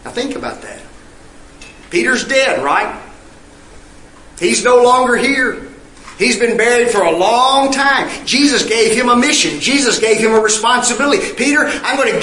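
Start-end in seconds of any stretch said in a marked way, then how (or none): none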